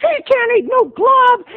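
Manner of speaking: high-pitched playground jeer; jeering kids. Retold or imitated. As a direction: taunt like a pack of little kids. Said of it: "they were like".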